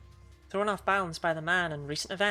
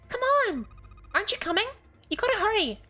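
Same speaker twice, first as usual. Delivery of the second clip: put-on very high, bright, urgent girl; 5 dB louder than usual. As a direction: squeak it out, hurrying him. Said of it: "a girl with pink hair and a high voice".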